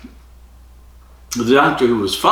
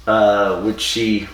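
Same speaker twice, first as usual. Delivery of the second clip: ordinary talk, nothing marked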